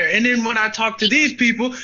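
normal talking voice

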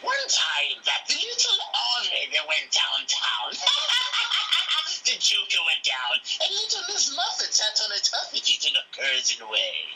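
high, manic Joker voice; Joker storytelling voice. Retold or imitated. Dad reading a bedtime story to his kids as the Joker. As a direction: cackle through a twisted bedtime story. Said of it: "reading a bedtime story, I'll be like".

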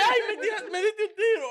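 chillido agudo de susto entre risas; susto fingido. Acted. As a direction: exagera el susto, voz muy aguda y riendo. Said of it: "porque me asusté". (susto de broma, muy agudo)

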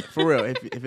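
laughing. Real, not acted. Laughing appeal to listeners.

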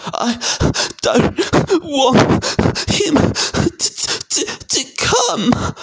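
high wail broken by fake sobs; pretend sobbing yell. A narrator's reading. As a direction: bawl it in hammed-up gulping sobs. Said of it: "yelled between huge pretend sobs".

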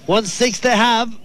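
usual speaking voice

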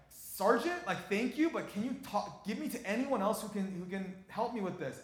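high, loud, urgent pleading; desperate plea. Retold or imitated. Pastor begging the sergeant for help, urgent and loud. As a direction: push hard for any answer.

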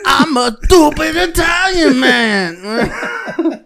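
high, slow, drawn-out cartoon Italian; mock Italian accent. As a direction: belt out a hammed-up Italian caricature. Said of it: "Can I make fun of Italian people?".

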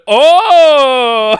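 huge, high, long "oh"; loud, over-the-top waitress excitement; imitated delighted gasp. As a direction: react as if it's thrilling news.

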